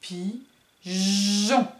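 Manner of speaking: "Pigeon" with short "pi", pause, then long buzzed "jjjon"; stretched middle J. Shown as a demonstration. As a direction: lengthen the "ge" sound in the second half of "Pigeon". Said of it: "C'est sur le deuxième doigt".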